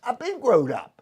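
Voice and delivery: retold gruff comeback; mock manager comeback. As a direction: snap back like the old skipper. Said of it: "He said".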